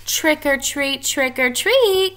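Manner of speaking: high, loud singsong call. A narrator's reading. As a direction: call the monsters to come eat. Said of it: "called Naggy Witch".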